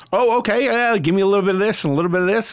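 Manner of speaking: tossing off easy monitor requests; pleased musician. Retold or imitated. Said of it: "and I was just like".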